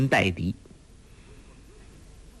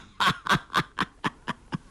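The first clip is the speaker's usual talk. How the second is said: put-on breathy old man's belly laugh; old beggar laughs with his friend; hearty laugh; 9 semitones higher, 4 dB quieter than usual. A narrator's reading. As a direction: laugh with the joke. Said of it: "两人相对大笑".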